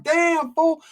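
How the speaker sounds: impressed reaction to a quick knockout; amazed exclamation. Retold or imitated. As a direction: let it out in disbelief.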